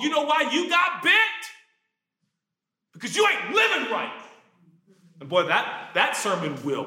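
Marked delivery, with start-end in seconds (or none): 0.0-4.1 accusing shout. Acted. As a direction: hurl the blame at them. Acting judgmental church people.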